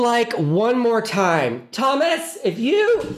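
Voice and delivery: high, slow mock warning, stretching each word; teasing the host with a playful threat; mock scolding. Playfully threatening the host, high and slow.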